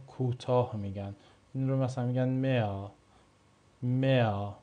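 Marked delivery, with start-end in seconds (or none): 2.3-4.5 میا with the h dropped and the vowel drawn out; silent German h. Shown as a demonstration. Teacher contrasting the spoken word with the spelling. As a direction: stretch میا, no h sound.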